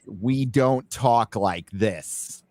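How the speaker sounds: very slow, every word of "we don't talk like this" separately articulated; over-enunciate each word in "we don't talk like this", drawn out; over-enunciated. Shown as a demonstration. Mocking British actors' American accents, "we don't talk like this" over-enunciated. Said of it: "you over enunciate way too much".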